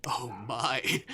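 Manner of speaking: loud, theatrical gasp of surprise; mock shock. Demon pretends shock at going unrecognised, dramatic. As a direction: play shocked with a big "oh my". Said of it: "He gasps dramatically, pretending to be shocked".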